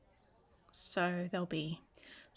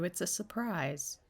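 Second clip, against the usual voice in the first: ordinary talk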